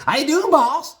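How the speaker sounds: very high, loud suck-up voice; fawning Bobby. Retold or imitated. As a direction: imitate an overeager underling greeting the boss. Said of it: "This is exactly what he said. This is exactly how I sounded".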